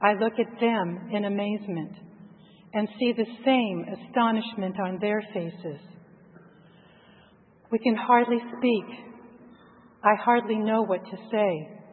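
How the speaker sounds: usual speaking voice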